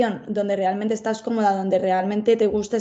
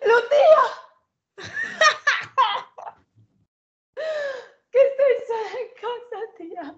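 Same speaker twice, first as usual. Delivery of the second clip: much higher voice, laughing and crying out; panicked laughter. Real, not acted. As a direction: laugh through the fear. Scared, laughing alone in dark.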